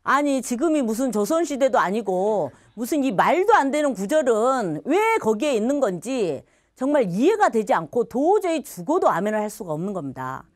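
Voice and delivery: ordinary talk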